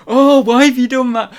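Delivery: high, loud put-on wail of reproach; hammed-up impression of a whining friend; Zahir. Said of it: "All I can do is the chicken thing".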